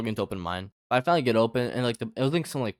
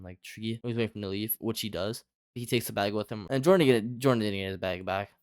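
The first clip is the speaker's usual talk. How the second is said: ordinary delivery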